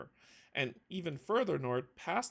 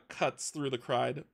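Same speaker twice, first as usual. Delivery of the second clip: ordinary delivery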